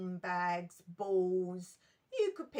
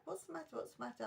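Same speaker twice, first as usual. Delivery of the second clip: very soft, quick acted concern; concerned hush; 6 semitones higher, 8 dB quieter, much faster than usual. Dinner lady to crying child, hushed concern. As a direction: ask it gently, well under your usual volume.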